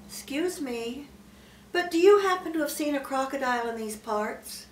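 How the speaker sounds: put-on politely asking, a touch childlike; polite elephant's child. A narrator's reading. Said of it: "most politely".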